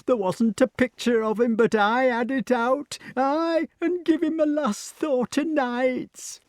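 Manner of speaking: high, slow character voice; keen it out high and drawn-out; emotional old retainer. A narrator's reading.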